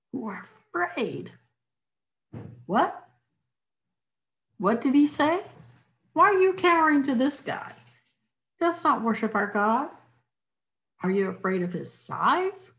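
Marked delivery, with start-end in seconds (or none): 2.7-12.7 indignant. Acted. David indignant at cowering soldiers.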